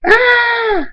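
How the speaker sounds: very high, drawn-out scream. A narrator's reading. Rain screams as tornado comes down, high scream. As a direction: shriek as if being swept away. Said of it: "Rain screams".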